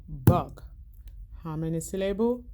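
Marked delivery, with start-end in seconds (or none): 0.1-0.6 "Bug" called out louder, one beat on the table; one firm beat on "Bug"; word banged out. Shown as a demonstration. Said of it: "Let's bang the table".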